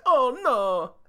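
high, loud cartoon Italian wail; hammed-up dismay in an Italian accent; Mario. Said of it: "that's the other thing Mario says".

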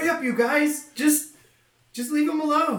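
high, urgent call to the team; urgent shout. Acted. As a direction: hurry them along, shouting. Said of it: "Chow's like".

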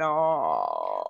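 very low, drawn-out mock groan; dismayed groan. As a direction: sink into a long, low "oh" of dismay. Acting out dismayed reaction to accent.

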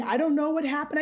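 high-pitched imitation of tearful denial; mock crying. Boys denying it to the teacher, mock tearful. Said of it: "And they're crying".

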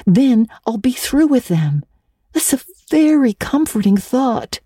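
put-on high girlish voice, tearful and fluttering with embarrassment; young Anne voice. Anne begging Marilla not to face Missus Allen, high and teary. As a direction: play the mortified little orphan girl, stumbling over words.

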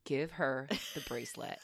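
soft and low, an eerie inner command; low quiet mystery voice. Retold or imitated. The unseen voice telling her to give it away, low and quiet. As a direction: murmur it like a voice from nowhere. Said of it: "in this androgynous voice that was, like, nondescript".

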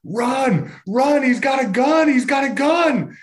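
high, slowed shriek of warning; terrified woman yelling a warning on the trail; panicked yelling. Retold or imitated. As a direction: scream it like she's fleeing a gunman. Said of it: "this woman just starts yelling".

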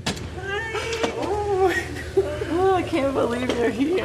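high, slow, delighted greeting with a laugh; overjoyed to finally meet; joyful reunion. Real, not acted. First meeting, joyful greeting.

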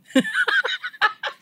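high delighted giggle; giddy high laugh. Real, not acted. Laugh alone.

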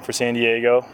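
ordinary delivery

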